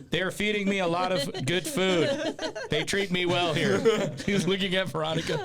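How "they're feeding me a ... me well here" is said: slow, high, forced reassurance; hostage's fake contentment; mock hostage. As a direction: recite it like a captive, barely holding the laugh. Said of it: "he looks like a hostage taker".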